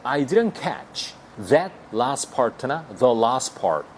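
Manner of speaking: very slow, every word spaced; teacher modelling slowly; slow demo. Shown as a demonstration. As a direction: spell it out for learners. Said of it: "이렇게 하시면".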